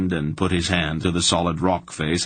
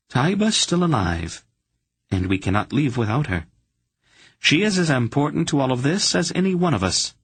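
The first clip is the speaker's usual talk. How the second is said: a higher, insistent voice for Polgara; 8 semitones higher than usual. A narrator's reading. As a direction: she won't leave anyone behind.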